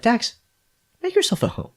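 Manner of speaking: high, soft coo to a little pet; pet command. Acted. Player to pet mouse on a mission, high and soft. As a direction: send the pet off in a light high voice.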